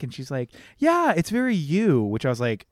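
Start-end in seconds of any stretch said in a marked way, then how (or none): 0.8-2.0 high, pointedly polite mock approval; backhanded compliment in a raised voice; passive-aggressive. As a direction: imitate a passive-aggressive mother. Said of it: "So it's very passive-aggressive".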